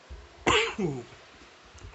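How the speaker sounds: harsh hacking cough; coughing fit. Real, not acted. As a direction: cough hard, out of control. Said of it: "try not to cough".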